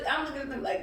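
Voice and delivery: usual speaking voice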